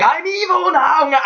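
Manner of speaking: high, slow hammy villain shout; mock villain shout. Mocking the wizard's turn, hammy villain shout. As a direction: bellow it like a cartoon baddie. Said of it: "He was just like".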